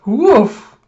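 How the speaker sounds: big, loud, high woof; dog bark. Acted. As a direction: imitate a dog's forceful bark. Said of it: "And he's like".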